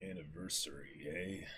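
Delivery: cold Kuro. Acted. Kuro to Merry, low and soft with hidden menace. Reading villain Kuro coldly.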